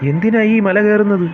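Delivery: shouting while running. Acted. A chaser shouts after the fleeing rabbit, breathless.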